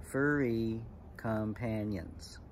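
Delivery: very slow, syllable by syllable; measured haiku recital. Shown as a demonstration. Teacher reciting an example haiku to kids, slow and measured. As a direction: draw out each word for counting.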